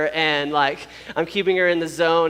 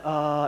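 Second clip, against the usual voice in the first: easy, relaxed "ahh"; imitated gentle labor "ah"; calm "ah"; 2 semitones lower than usual. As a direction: breathe out a mild, controlled "ah". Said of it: "instead of like".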